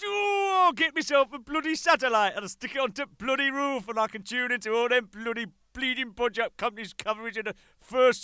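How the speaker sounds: high, loud put-on northern scheming; gleeful plan in a mock voice; excited Yorkshireman. Manager planning a satellite dish, excitedly. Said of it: "So he thinks".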